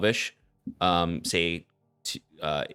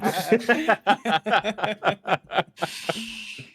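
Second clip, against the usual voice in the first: long, high peal of laughter; 14 semitones higher, 4 dB louder than usual. Real, not acted. Table laughs at the joke.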